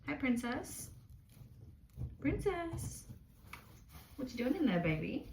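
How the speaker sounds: high cooing voice for the guinea pig. Real, not acted. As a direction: coo softly at your little pet.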